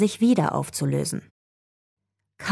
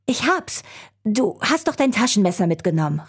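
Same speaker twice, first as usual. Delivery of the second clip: sudden high-pitched realisation, easing down; calling out; 4 semitones higher than usual. A narrator's reading. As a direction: call it out as the idea strikes. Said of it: "rief Jacob laut".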